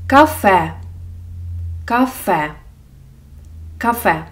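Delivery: кафе with a clear final е; careful Ukrainian word. Shown as a demonstration. Teacher models кафе for learners. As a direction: say кафе, stress on the last syllable. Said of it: "Наприклад".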